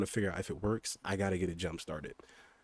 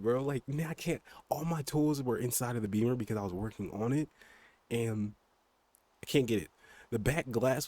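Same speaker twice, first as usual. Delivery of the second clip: worked up, higher and louder; getting really excited; 4 semitones higher, 4 dB louder than usual. Real, not acted. Streamer venting about the car's new problem, really excited. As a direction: let car talk run away with you, pitch climbing. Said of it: "I'm getting really excited".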